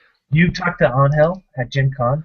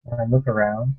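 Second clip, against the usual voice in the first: usual speaking voice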